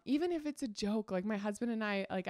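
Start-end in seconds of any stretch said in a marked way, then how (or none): none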